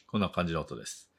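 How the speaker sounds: usual speaking voice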